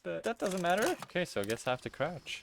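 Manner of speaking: ordinary talk, nothing marked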